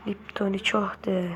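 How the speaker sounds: whispered "Lipton'u çok de"; murmured whisper. Real, not acted. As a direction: whisper the phrase to yourself. Muttered catchphrase while playing.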